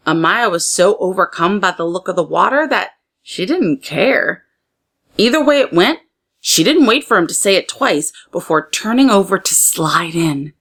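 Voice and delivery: ordinary delivery, nothing marked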